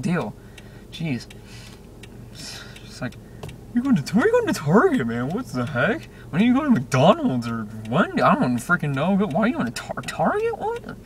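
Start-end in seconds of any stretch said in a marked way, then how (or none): 3.7-11.0 hammed-up rant swinging into falsetto; mock an overwrought friend, pitch leaping up; high-pitched mock outrage; imitating freak-out. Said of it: "Quit freaking out".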